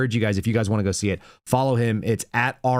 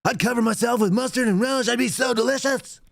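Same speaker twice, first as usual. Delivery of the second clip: cartoon Harry Carey; 11 semitones higher than usual. Host imitating Harry Carey as a cartoon announcer. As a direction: do a goofy drunken old ballpark announcer. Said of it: "like cartoon Harry Carey".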